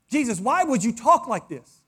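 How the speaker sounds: usual speaking voice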